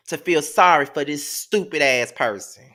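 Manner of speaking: ordinary talk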